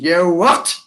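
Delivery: imitating mum's disapproving query; mum's challenge. Mum challenges daughter's broad Geordie, disapproving query. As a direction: question it sharply, voice rising at the end. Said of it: "She come back, she says".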